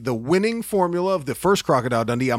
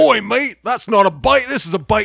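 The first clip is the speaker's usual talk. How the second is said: louder, higher Australian boast; Aussie bravado; 4 semitones higher, 4 dB louder than usual. Retold or imitated. Hero mocking the gator in a loud Australian accent. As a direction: ham up the Aussie tough guy. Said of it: "And he's like".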